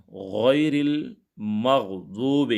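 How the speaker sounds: very slow, drawn-out melodic recitation; Quran recitation. Acted. Teacher recites the Fatiha for his students, slow Quranic chant. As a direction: chant each word slowly like in prayer.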